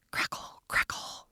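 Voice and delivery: soft, voiceless whisper. Shown as a demonstration. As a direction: whisper it like a crackling fire.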